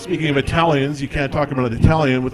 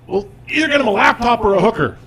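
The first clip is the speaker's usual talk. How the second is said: higher, louder exasperated blurt; blurting sister; 8 semitones higher, 4 dB louder than usual. Retold or imitated. Sister at her wit's end, higher exasperated blurt. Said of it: "she blurts out".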